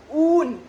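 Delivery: ऊन with a loud, stretched 'oo'; long drawn vowel. Shown as a demonstration. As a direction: draw out the ऊ in ऊन. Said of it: "बड़ा ऊ होता है वो खींच के".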